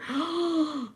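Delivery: sharp high gasp; startled gasp. Real, not acted. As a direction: suck in air in surprise. Gasp of amazement.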